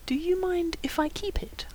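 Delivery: high, very soft, near whisper; hushed, coaxing question; coy request. A narrator's reading. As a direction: ask sweetly under your breath. Lydie sweetly asks to keep book.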